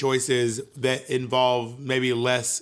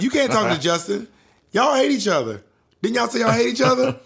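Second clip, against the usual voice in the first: higher-pitched mock motherly challenge; retold indignant questioning; scolding mom; 8 semitones higher, 4 dB louder than usual. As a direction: imitate a mom catching out her kids. Mom scolding in retold punishment story.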